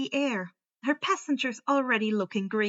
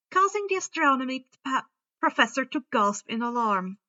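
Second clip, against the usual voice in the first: plain reading voice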